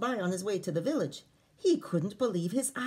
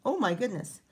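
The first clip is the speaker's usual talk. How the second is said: gasping surprise; 4 semitones higher than usual. Real, not acted. Reader reacts to story.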